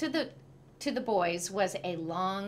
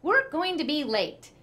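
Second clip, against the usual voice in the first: higher, louder call; husband calling to wife from downstairs; calling upstairs; 8 semitones higher, 5 dB louder than usual. A narrator's reading. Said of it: "called to his wife".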